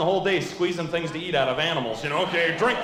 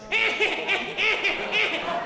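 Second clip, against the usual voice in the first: much higher shrill cackling laugh; mom's laugh imitated. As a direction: cackle high like the mother. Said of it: "but she laughs like this".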